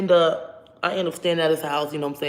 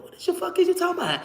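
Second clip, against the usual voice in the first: incredulous outburst; 13 semitones higher, faster than usual. Retold or imitated. Retold confused outburst.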